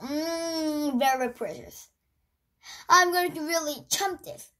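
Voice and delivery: talking between bites of meringue; savouring a mouthful of sweets; chewing with mouth full. Real, not acted. Seen 1: Savouring meringue with eyes closed, then bites more while talking.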